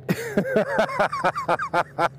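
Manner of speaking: high, long burst of laughter; real laugh. Real, not acted. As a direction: crack up at your own story. Storyteller laughs at his story.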